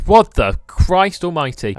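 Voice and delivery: stunned outburst at the sight; shocked. Real, not acted. Shocked at weird room.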